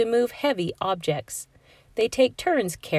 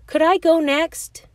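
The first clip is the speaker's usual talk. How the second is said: high, bright eager plea; a hint of schoolroom eagerness; 10 semitones higher, 5 dB louder than usual. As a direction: ask it like a keen student who can't wait. Eager student voice in story.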